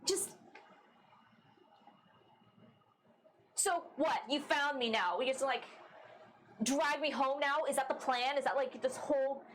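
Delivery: put-on angry runaway confronting her old crew; angry Astra. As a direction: lash out at the friends who tracked her down.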